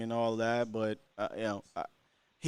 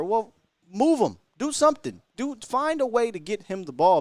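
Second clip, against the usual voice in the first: frustrated outburst; 8 semitones higher, 4 dB louder than usual. Real, not acted. Frustrated rant about feeding Lamb.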